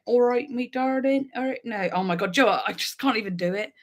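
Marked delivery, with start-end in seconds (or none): 0.0-1.6 broad Devon greeting, friendly; Devon accent. Shown as a demonstration. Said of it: "I sound a little bit more like".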